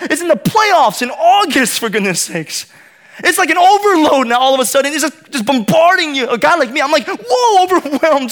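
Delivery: high, loud sports-fan gushing; worked-up excitement. Retold or imitated. Pastor gushing to congregation about hockey's return, excited. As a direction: get carried away, loud and high-pitched.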